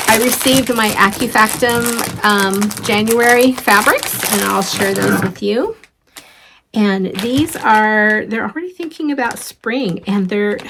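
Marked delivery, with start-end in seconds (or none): none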